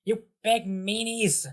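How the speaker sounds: high, slow pouting whine; whiny villain kid. Acted. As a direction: drag out the sulky insult. Bowser Junior sulking at players.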